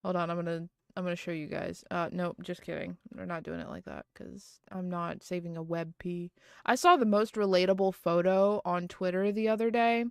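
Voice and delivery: ordinary talk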